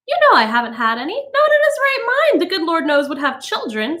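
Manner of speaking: high, huffy retort; indignant society woman. A narrator's reading. Mrs Phelps answering Montag about children, huffy. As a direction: snap back offended.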